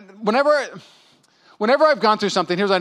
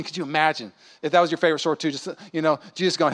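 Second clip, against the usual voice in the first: normal talking voice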